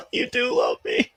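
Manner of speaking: high, breathy laugh through the words; laughing so hard the words squeak out. Real, not acted. Friend says he loves him.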